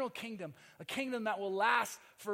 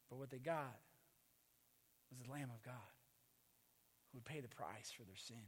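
hushed solemn preaching; 8 semitones lower, 10 dB quieter than usual. Real, not acted. Pastor softly reflects on the Lamb of God, hushed. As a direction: let the words sink in quietly.

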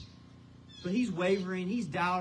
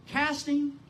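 Casting hit high and loud; stress on casting; 11 semitones higher, 6 dB louder than usual. Shown as a demonstration. Pastor to congregation, stressing Casting. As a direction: lift the word Casting right up. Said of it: "Did you catch it?".

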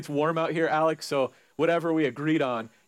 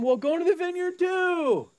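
high, bright-pitched owner voice; upbeat landowner waving them in; cheery master; 13 semitones higher than usual. Acted. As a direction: sing it out like a jolly boss.

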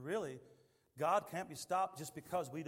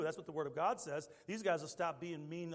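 ordinary delivery

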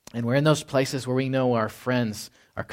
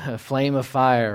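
ordinary talk, nothing marked

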